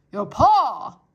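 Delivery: loud shout. Acted. Soldier shouting to deaf Mig. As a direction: call it out slowly and loud. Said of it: "shouted the soldier".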